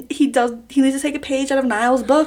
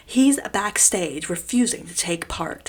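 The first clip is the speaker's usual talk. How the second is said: slightly lower, softer, slow narrator read; voiceover narrator; 3 semitones lower, 6 dB quieter, slower than usual. Retold or imitated. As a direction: lightly imitate a measured voiceover. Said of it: "voiceover narrator is like".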